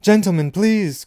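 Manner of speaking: high, loud appeal for order; pleading cry. A narrator's reading. Shop assistant appealing to brawling gentlemen, crying out. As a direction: cry out over the commotion. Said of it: "cried the assistant".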